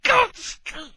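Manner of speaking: loud, high cry of despair; a loud outraged wail, then ranting; exasperated shout. Retold or imitated. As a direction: howl at the heavens. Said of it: "I'm going".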